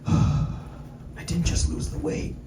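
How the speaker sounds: soft, slow, breathy guru; sighing self-satisfied fitness guru, soft and slow; serene guru voice. Retold or imitated. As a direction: preach inner peace gently.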